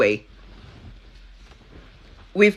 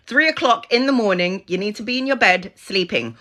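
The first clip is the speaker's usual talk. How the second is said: ordinary talk, nothing marked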